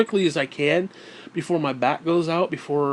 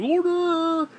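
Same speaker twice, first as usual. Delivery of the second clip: high squeaky shout of Dale T; yelled in a higher mocking voice; mock bossy shout; 13 semitones higher than usual. Buddy Dale T honking outside the store, squeaky mocking shout.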